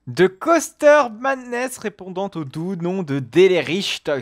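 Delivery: put-on slow, deliberately clumsy German accent on the park's name. YouTuber jokingly gives the coaster name in a cringey German accent. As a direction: ham up a German accent as a joke. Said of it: "un bel accent allemand assez gênant".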